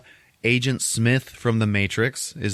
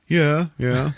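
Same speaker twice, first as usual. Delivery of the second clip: high-pitched quick agreement; mock eager villain; 8 semitones higher, 4 dB louder than usual. Eye of Sauron agreeing with the Joker, high-pitched mock voice. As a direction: pipe up high as the giant eye.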